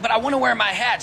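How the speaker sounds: mock her whining for the hat; whiny quote. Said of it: "She said".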